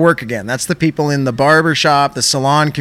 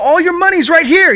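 much higher, exasperated mock yell; 12 semitones higher than usual. Agent yelling at the caller in exasperation. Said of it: "He's yelling at me".

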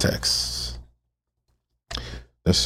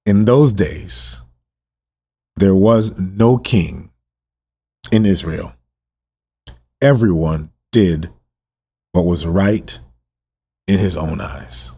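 solemn scripture reading. Real, not acted. Preacher reading the last verse of Judges, solemn.

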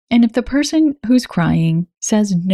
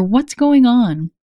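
gentle question; 4 semitones higher, slower than usual. Shown as a demonstration. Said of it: "Keep it gentle and inquisitive".

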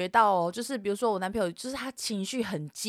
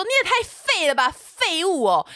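high-pitched mock insult; scornful taunt; 7 semitones higher, 4 dB louder than usual. Taunting a silent boyfriend in the fight, scornful. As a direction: re-enact a scornful jab at a partner.

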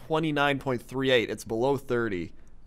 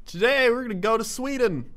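mock weather report; 10 semitones higher, 4 dB louder than usual. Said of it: "how's that weather".

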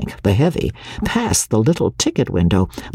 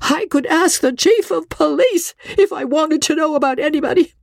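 high, trembling insistence; high, quavering. A narrator's reading. Frightened wife pleading high and quavering with her husband. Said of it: "Her voice was high and quavering".

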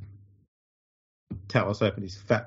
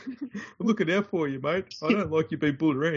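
higher, soft fake-friendly mate voice; mock friendly voice; 7 semitones higher, 4 dB quieter than usual. Mimicking Tallis on phone.

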